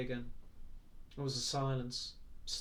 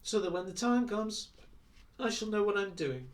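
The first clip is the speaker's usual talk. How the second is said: contemptuous; 6 semitones higher, 5 dB louder than usual. A narrator's reading. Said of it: "he added contemptuously".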